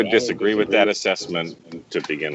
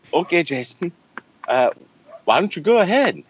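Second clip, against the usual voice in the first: higher-pitched, badly faked Scottish accent; bad Furry accent; 7 semitones higher than usual. Mocking what Furry would say, in a horrible fake Scottish accent. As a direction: attempt your friend's Scottish accent and botch it. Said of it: "that was a horrible fucking accent".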